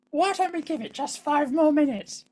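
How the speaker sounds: high put-on wife voice; wife impression. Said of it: "She doesn't really speak like that".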